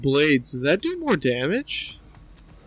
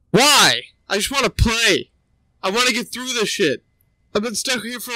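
whiny frustration; 5 semitones higher, 4 dB louder than usual. Real, not acted. Gamer pleading in frustration.

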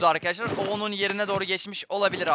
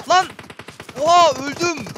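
loud, high startled yell; real jolt of surprise, much louder and higher; shocked shout; 7 semitones higher, 8 dB louder, slower than usual. Real, not acted. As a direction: yelp as the shot hits you. Sudden near-death shock.

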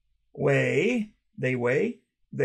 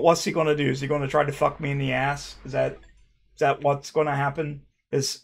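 usual speaking voice

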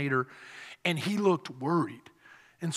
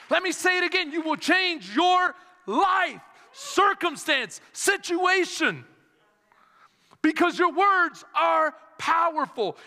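rhythmic preaching, climbing higher with each repeat; building preaching refrain; 11 semitones higher, 4 dB louder than usual. Real, not acted. Preacher urging the church to change their words, building fervent refrain. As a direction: drive each promise home, stretching the last ones out slowly.

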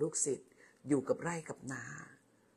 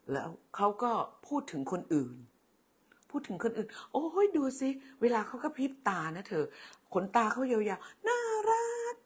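jealous gushing lover; 7 semitones higher than usual. Acted. Jealous lover imitates partner praising someone else, mock swooning. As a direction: coo in a high, teasing, mock-swooning voice. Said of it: "ต่อต้านตนเอง".

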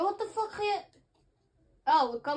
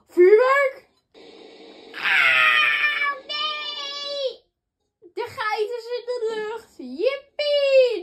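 excited shrieking; 11 semitones higher, 10 dB louder, much slower than usual. Real, not acted. Goats flung up by fireworks.

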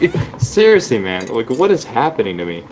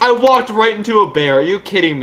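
startled disbelief; 10 semitones higher, 3 dB louder than usual. Real, not acted. Walked into a bear.

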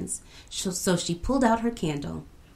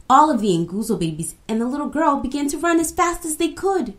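excited narration; 8 semitones higher, 5 dB louder, faster than usual. A narrator's reading. Narrator tells the chase.

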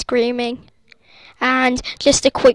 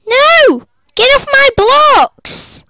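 high, loud, slow furious yell; angry scream; 12 semitones higher, 9 dB louder, slower than usual. Real, not acted. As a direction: scream at the griefer to stop. Someone breaks his blocks.

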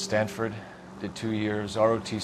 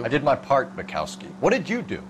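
snapping back louder and higher, defensive; angry retort; 5 semitones higher, 4 dB louder than usual. Acted. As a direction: fire it back at the partner who mocks your medal. Partner needling him about war.

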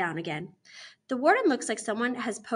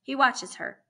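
plain reading voice

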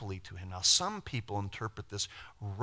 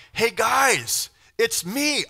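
bright shouted greeting, high and loud; cheery shout; 12 semitones higher, 8 dB louder, much slower than usual. Retold or imitated. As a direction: call out to the brothers like an old friend. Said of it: "if Joseph would have been like".

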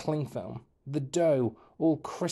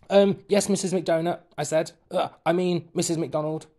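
higher, louder flustered reply; 6 semitones higher, 6 dB louder than usual. Acted. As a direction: blurt it out caught off guard. Startled boy answering teacher.

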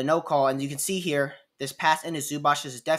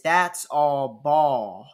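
slowly insisting, a bit louder; slow insistent protest; 5 dB louder, much slower than usual. Retold or imitated. Player pleading with the referee, slow and insistent. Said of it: "what I think he says".

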